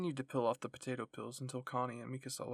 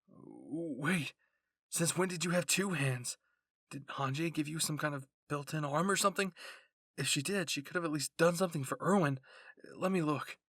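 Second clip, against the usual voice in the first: a startled, louder outburst; shocked; 2 semitones higher, 7 dB louder than usual. A narrator's reading. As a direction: demand to see it. Aaron shocked at her hand.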